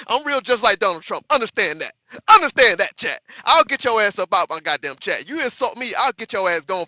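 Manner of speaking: loud, laughing boast at chat; half-joking, bellow the warning; laughing loud threat. Real, not acted. Laughing threat to ban chatters.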